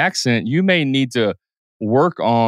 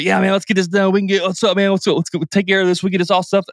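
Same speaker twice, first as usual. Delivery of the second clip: quick, pitched-up pushy energy; fast and hyped; 7 semitones higher, faster than usual. Shown as a demonstration. Talking too fast to a veteran, hyped. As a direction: rush it like a pumped-up salesman. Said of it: "I'm not gonna go in there and be like".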